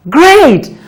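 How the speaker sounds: high bright praise; teacher praise. Real, not acted. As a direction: enthusiastic classroom praise. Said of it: "praising child".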